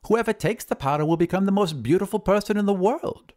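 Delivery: high wizard voice; high-pitched wizard making a promise; boastful wizard. Acted.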